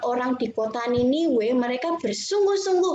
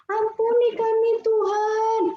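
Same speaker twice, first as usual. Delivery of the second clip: pleading for forgiveness; 10 semitones higher, 3 dB louder than usual. Acted. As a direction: act out a crowd begging God for mercy. People repent, crying to God. (memohon ampun)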